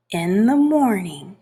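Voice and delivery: ordinary delivery, nothing marked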